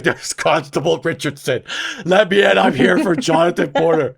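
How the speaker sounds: loud, commanding police officer act; Constable Richardson. Retold or imitated. Brother pounding on the front door posing as police, hammed-up authority. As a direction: call through a door as a fake constable. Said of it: "pretending he's Officer Constable Richardson".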